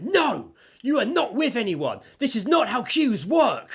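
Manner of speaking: high, loud mock outrage; acted indignant telling-off. Queue-goer scolding a pusher-in, indignant. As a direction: imitate an indignant woman laying down the law. Retelling woman confronting queue jumper.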